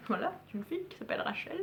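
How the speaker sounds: confiding whisper to the viewers; whispered aside. Real, not acted. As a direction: drop to a whisper and rush the name. Whispered aside about the blogger.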